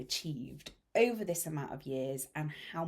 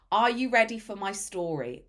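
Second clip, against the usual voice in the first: ordinary talk, nothing marked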